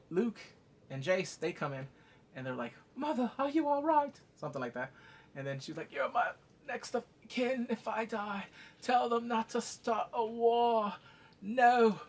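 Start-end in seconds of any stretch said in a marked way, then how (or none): 3.0-4.2 high, proper concerned voice; put-on young son. Sons checking on their mother, high put-on voice. 5.9-12.0 high, drawn-out plea; mock strained queen. Laboring mother instructing her son, high and strained.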